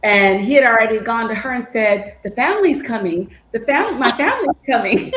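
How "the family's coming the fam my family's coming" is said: retold happy announcement, higher and half-laughing; excited announcement. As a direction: imitate an eager waiter spreading the news. Retells waiter proudly announcing the family.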